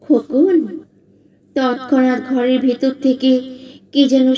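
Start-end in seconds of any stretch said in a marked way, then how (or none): 0.0-0.8 lightly mimicked female call; imitated woman's voice. A narrator's reading. Shadowy figure lures the boy, imitating a woman's call. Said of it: "সেই নারী কণ্ঠ নকল করে ডাকে".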